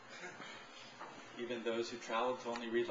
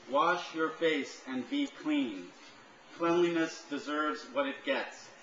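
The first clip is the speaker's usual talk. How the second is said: higher, louder declaimed lines; insistent poem recital; 5 semitones higher, 5 dB louder than usual. Acted. Poet questions listeners about deserving money, insistent declaiming. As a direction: press each question on the audience.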